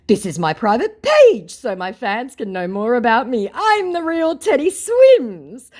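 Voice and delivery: high, loud, pompous proclamation; declaring scammer. Retold or imitated. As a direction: proclaim it as a fake celebrity. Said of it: "declares my scammer".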